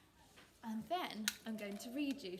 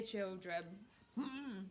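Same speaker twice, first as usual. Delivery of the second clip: ordinary delivery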